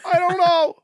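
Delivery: high, loud sitcom shrug; comic whiny standup delivery; Jerry Seinfeld voice. Pretend. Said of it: "Why am I talking like Jerry Seinfeld?".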